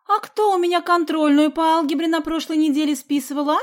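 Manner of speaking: high, loud accusing challenge; heated schoolgirl quarrelling; indignant accusation. A narrator's reading. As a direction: demand an answer, outraged. Соня продолжает перепалку с Сидякиным.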